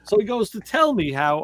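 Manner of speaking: ordinary talk, nothing marked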